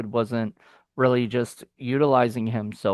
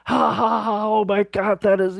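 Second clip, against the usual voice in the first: high, hammed-up fake guffaw; mock belly laugh; 8 semitones higher than usual. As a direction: imitate someone cracking up at a knee-slapper. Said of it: "Like, it's a knee slapper".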